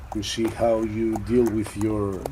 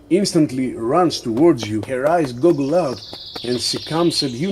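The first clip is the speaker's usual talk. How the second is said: ordinary talk, nothing marked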